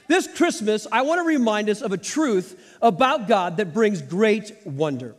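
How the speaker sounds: ordinary talk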